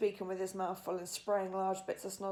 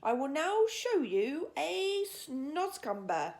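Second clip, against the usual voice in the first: slow, higher, louder showman giant; grand giant; 6 semitones higher, 5 dB louder, much slower than usual. A narrator's reading. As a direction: present it like a grand reveal. BFG announcing.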